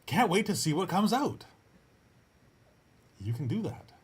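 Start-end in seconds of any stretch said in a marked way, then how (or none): 0.1-1.5 loud, high, gushing excitement; excited pep talk. Acted. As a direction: fake it big, thrilled to get going. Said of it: "saying it in that tone of voice".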